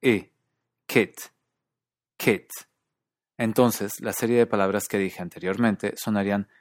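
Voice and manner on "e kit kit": KIT with a lax 'ih', as in US and standard British; relaxed short I. Shown as a demonstration. Teacher isolating the vowel for students, lax short I in KIT. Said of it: "tiende a sonar como una I relajada".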